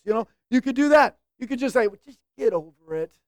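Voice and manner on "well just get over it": condescending. Acted. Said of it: "you could be condescending like that".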